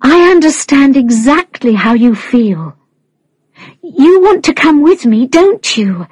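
high, gentle reassuring voice; softly. A narrator's reading. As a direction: soothe a crying child from below. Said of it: "she said softly".

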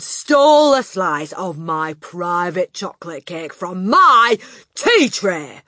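loud, relentless berating; furious tirade. Acted. Headmistress berating the boy about her cake. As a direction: rant at him without pause.

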